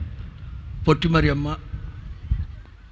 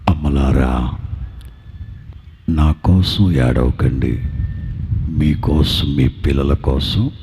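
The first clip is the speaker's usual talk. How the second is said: very deep, slow, solemn voice; grave low-pitched address to the mothers; voice of Jesus; 4 dB louder, slower than usual. Acted. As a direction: speak as the Lord bearing the cross, far lower than your own. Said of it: "సిలువ మోస్తూ నా ప్రభు చెప్పిన మాట".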